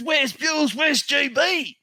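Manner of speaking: imitating fan; high excited fan voice. Eager stranger asking after the hosts. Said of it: "He goes".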